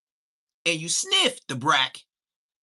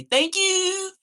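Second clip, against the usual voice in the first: high thank you; 5 dB louder than usual. Shown as a demonstration. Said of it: "that's why I say".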